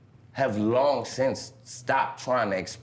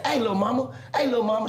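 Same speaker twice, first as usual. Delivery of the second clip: higher, smooth catcall; flirty catcall; 8 semitones higher, faster than usual. Retold or imitated. Calling to her from the car, higher flirty catcall. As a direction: sweet-talk her from the car. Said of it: "I say".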